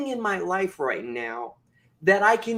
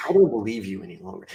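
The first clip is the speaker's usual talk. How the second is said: very low, quick rebuff; fast, low-pitched, decisive dismissal; firm self-talk; 10 semitones lower, much faster than usual. Shown as a demonstration. Speaker repeating his rebuff to doubt, firm self-talk. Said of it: "I talk like this all the time".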